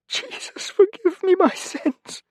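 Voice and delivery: high, slow, broken sob on "forgive me"; sobbing prayer. Acted. Rodrigo begging Jesus for forgiveness, sobbing slowly. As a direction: weep out the plea for forgiveness. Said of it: "he sobbed".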